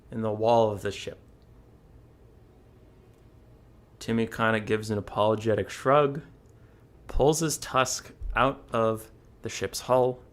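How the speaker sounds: soft, low storyteller pause; hushed suspense. A narrator's reading. Streamer narrates the frozen moment, soft and low. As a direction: hold the room in a quiet hush.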